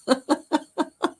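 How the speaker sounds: high rapid giggle; real burst of high laughter. Real, not acted. Amused by how a viewer likes her saying vehicle, laughing.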